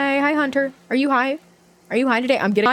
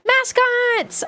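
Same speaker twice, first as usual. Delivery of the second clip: excited; 12 semitones higher than usual. Real, not acted. Excited reveal of new mascots.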